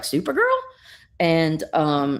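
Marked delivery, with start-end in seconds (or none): 0.0-0.6 put-on high-pitched, rising little-girl query; little girl questioning. Little girl checking on frozen Supergirl, high and questioning. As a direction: call her name as a puzzled girl, pitched way up. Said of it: "the little girl's like".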